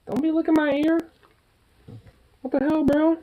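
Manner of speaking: squealy high complaint; high-pitched protest. Real, not acted. Bird licking ear.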